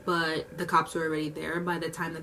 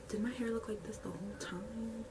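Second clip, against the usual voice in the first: whispered aside; 4 semitones higher, 11 dB quieter than usual. Retold or imitated. Coworker's worried aside quoted.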